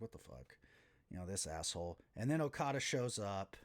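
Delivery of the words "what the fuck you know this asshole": low, hushed grumble under the breath; muttered aside. Retold or imitated. Matthews grumbling to himself about Okada, hushed mutter.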